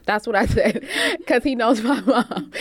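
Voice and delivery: talking through real laughter; laughing. Real, not acted. Explaining her threat to tell her mom, laughing.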